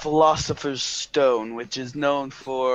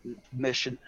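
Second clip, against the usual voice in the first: normal talking voice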